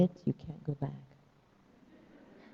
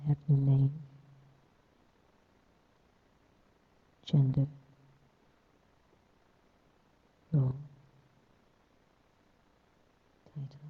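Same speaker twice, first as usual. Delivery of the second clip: guided meditation. Acted. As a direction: ease them into stillness.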